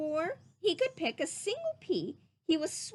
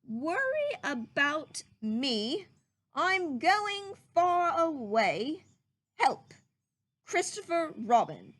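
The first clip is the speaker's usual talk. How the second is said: slow, solemn owl reading; slow owl reading a note; Owl reading aloud; 3 semitones higher, slower than usual. Acted. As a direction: read the note slowly like a grave old owl. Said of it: "then read it out loud".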